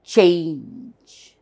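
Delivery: CHANGE as one long falling syllable; demonstrated pronunciation. Shown as a demonstration. Teacher shows a one-syllable word, demonstrated pronunciation. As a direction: stretch "change" out in a single stroke. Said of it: "Observe the pronunciation".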